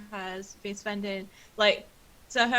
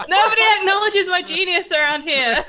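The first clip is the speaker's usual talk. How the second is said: loud, high mock outrage with laughter; Finnegan; 8 semitones higher, 12 dB louder than usual. Inventor grumbling about being unappreciated, hammed-up indignant boast. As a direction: play up the wounded genius, loudly. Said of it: "You're starting to sound like Finnegan".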